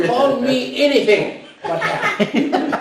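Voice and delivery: slow, loud, laughing protest. Retold or imitated. Laughing retelling of name joke.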